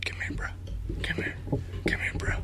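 hushed, voiceless beckoning; retold whisper. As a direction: whisper it like calling a brother over on the quiet. Retold brother secretly calling him over.

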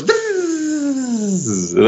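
high, thin buzzing zip line noise. Retold or imitated. To the audience, imitating the zip line buzz. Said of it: "that's the noise a zip line makes".

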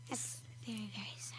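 whispered, mock sad. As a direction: sigh it out in a hush.

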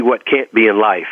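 normal talking voice

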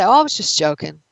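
usual speaking voice

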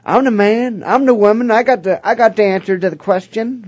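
high, swaggering mock boast; puffed-up ego. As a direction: play a prospect swollen with self-importance. Acts out prospect's inflated ego.

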